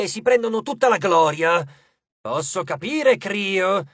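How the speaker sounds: loud, grandiose titan complaining; pompous titan. Acted. Titan complaining to his brother about waiting in darkness, pompous.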